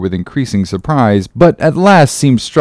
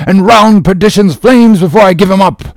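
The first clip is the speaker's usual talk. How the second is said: high, loud, impassioned rallying cry; rally the crew with a vow of vengeance; shouted out; 7 semitones higher, 4 dB louder than usual. Acted. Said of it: "he shouted out".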